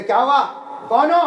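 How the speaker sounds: angry. Retold or imitated. Angry butcher turning on the man at his shoulder, irritated shout. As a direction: snap it out loudly at a stranger. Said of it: "वो तो गुस्से में था ही".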